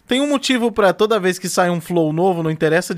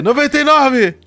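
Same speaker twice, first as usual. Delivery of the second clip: high, loud exclamation; pitched-up, loud burst of realisation; excited call-out; 8 semitones higher, 6 dB louder than usual. Real, not acted. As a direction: echo it back as a triumphant shout. Echoes co-host's excited call-out.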